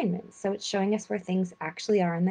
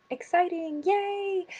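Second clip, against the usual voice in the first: high-pitched, drawn-out happy yay; little cheer; 12 semitones higher, slower than usual. Real, not acted. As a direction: cheer it lightly in a much higher voice. Cheering the new Capricorn season.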